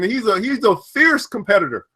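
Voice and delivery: normal talking voice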